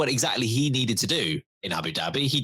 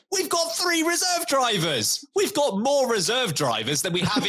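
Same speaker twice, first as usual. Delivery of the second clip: incredulous exclamation; 12 semitones higher than usual. Retold or imitated. Podcaster mocking Alpine's third reserve signing, incredulous outcry. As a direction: throw your voice up in disbelief.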